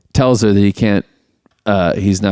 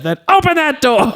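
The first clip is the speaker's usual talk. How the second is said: much higher put-on voice; mock command; 14 semitones higher than usual. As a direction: order it grandly. Quoting the movie.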